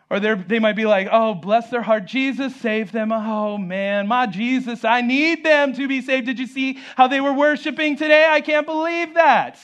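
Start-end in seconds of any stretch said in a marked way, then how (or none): 1.1-9.6 mock lament building high and louder; hammed-up pious horror, climbing in pitch; judgmental churchgoer. Second acted judgmental churchgoer.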